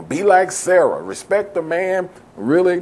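mock the people who push Sarah as the model; mocking imitation. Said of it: "people have put the emphasis on that".

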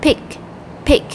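"pick" said as one separate syllable; first syllable alone. Shown as a demonstration. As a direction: say "pick" on its own, cut off from the rest of the word. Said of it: "âm tiết thứ nhất là".

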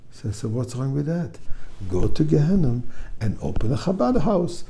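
ordinary delivery

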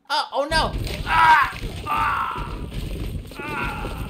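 loud, high panicked screams; screaming in pain. Real, not acted. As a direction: yell as you get hit. Screaming while being killed.

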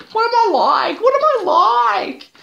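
high squeaky mocking voice; mock businessman. Imitating the businessman's baffled reply, high mocking squeak. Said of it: "he was all like".

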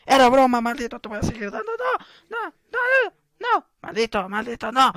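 high, frantic playful shouts; hammed-up yelling at an attacker; mock panicked yelling. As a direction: taunt turning into squealed protests. Resumes fighting, hurt again.

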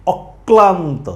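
slow অক্লান্ত as অক-ক্লান্ত; অক্লান্ত with ক heard twice; doubled ক. Shown as a demonstration. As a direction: hold the ক of অক্লান্ত before releasing it. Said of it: "ক এর উচ্চারণটা দ্বিত্ব হবে".

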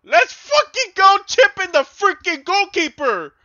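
yelling high and loud in pure joy; ecstatic shout. Real, not acted. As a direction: scream it like you just scored. Celebrates a chip goal.

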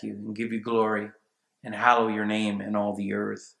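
low, hushed prayer voice; subdued, reverent appeal to God; humble prayer. Acted. Models a prayer for healing.

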